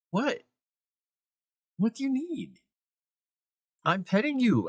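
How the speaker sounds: high sing-song voice for the cat; cat talk. Real, not acted. Owner to needy cat, high baby-talk voice. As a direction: coo up high at the pet.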